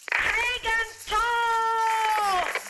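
high, drawn-out, very slow war cry; battle cry. Acted. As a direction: roar it as a charge. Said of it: "his familiar battle cry".

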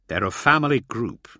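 usual speaking voice

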